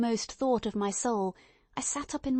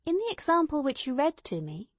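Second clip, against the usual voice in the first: higher, lightly voiced Watson, unconvinced; a touch of a puzzled doctor, raised pitch; Watson, doubtful; 6 semitones higher than usual. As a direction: Watson pressing his friend, doubtful.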